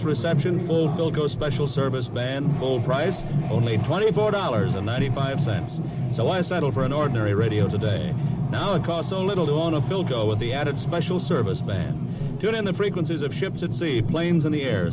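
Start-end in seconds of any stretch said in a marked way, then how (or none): none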